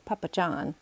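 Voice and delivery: usual speaking voice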